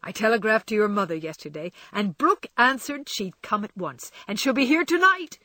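fast, rising, loud with glee; excited news. Acted. Laurie tells Jo her mother is coming, excited. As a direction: spill the good news eagerly. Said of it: "suppressed satisfaction".

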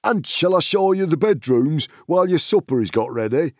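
high-pitched, helpful little servant voice; hobbit servant. A narrator's reading. Hobbit servant offering to show bedrooms, high and eager.

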